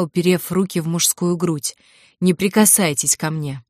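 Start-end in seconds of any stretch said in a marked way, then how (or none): none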